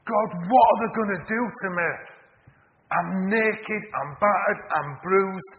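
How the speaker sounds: loud, high-pitched acted plea to God; desperate prayer. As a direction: cry out as a woman being dragged away. Voicing a dragged woman's desperate prayer.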